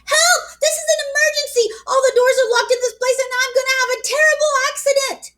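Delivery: put-on high, breathless child's plea for help; frantic. A narrator's reading. Girl calling 911 in a frantic panic. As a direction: blurt it all out in a panic. Girl's frantic emergency phone call.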